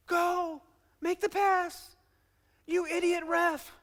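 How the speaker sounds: high-pitched imitation of a yelling fan; mock fan shouting. Football fans shouting at players and referee, high mock yell. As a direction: yell high without full volume. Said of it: "They'll shout".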